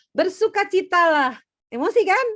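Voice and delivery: joyful proclamation. Acted. Teacher reading Paul's call to the class, joyful. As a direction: proclaim rejoicing with delight.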